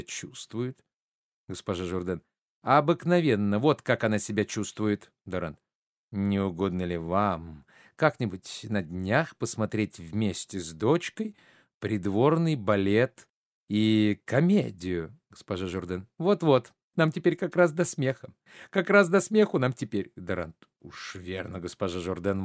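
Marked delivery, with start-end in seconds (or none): 2.6-5.1 emphatic start, then falling away; curt retort. Acted. Mother rebuffing a guest's question about her daughter, curt retort. Hostess throws his question back. 16.2-20.1 high, indignant sarcasm; scornful retort. Acted. Hostess rejecting an invitation to a comedy, high scornful retort.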